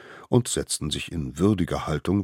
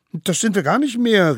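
high, loud protesting voice; crying out; 7 dB louder than usual. A narrator's reading. Little wild ones protesting loudly to the court. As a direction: protest with a shout. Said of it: "riefen die kleinen Wilden".

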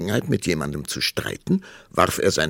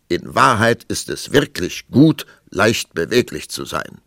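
louder, emphatic, settling to a close; argument-ending tone; 4 semitones higher, 8 dB louder than usual. A narrator's reading. King ending the quarrel with queen, with finality. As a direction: pronounce it as the last word. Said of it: "im Tonfall eines Mannes, der einen Streit beendet".

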